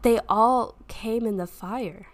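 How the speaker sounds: ordinary talk, nothing marked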